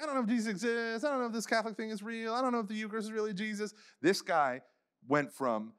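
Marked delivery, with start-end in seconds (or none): 0.0-3.7 fast, higher mocking voice of a skeptic; mock doubter. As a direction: rattle off the doubts in a higher put-on voice. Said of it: "some of us are like".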